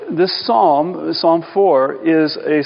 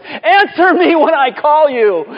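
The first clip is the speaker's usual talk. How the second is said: loud, high, desperate cry to God; emoji tone of voice; 11 semitones higher, 5 dB louder, slower than usual. Acted. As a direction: call out slowly, high and urgent, demanding an answer. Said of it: "Here, it goes more like this".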